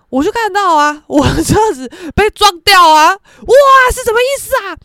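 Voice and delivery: loud indignant build, climbing high; retold outraged exclamation; imitated outrage. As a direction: act out mother's shock, rising to a shout. Mother retelling her outrage at damage.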